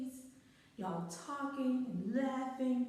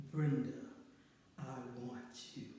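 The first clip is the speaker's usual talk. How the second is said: much lower and much softer, a hushed, breathy-sounding line; low seductive come-on; 9 semitones lower, 5 dB quieter than usual. Retold or imitated. The guy leaning in to tell his date, low hushed come-on. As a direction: purr it low like a smooth-talking date. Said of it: "And he repeated".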